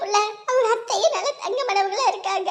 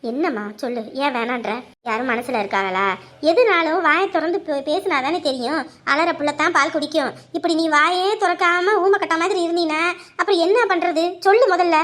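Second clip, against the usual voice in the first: coaxing elder; 6 semitones lower than usual. Acted. Elder coaxing the girl to accept marriage, low and soothing. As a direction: gently lecture the girl with proverbs.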